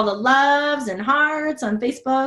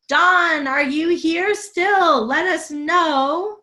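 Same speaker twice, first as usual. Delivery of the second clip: calling out; 5 semitones higher, much slower than usual. Real, not acted. Calling out to the winner.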